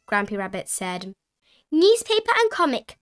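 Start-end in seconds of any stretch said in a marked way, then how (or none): 1.7-3.0 high little piglet voice; Peppa Pig. A narrator's reading. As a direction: call it out as a small child. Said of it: "Peppa said".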